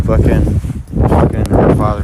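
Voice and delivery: loud, angry outburst about her father; getting angry. Real, not acted. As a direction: let the anger burst out loud. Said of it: "now I'm fucking getting angry".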